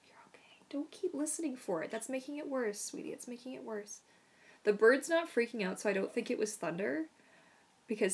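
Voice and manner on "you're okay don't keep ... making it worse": higher, soft whispered soothing; tender hush; worried whisper. Real, not acted. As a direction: whisper to the scared dog. Whispering to frightened dog.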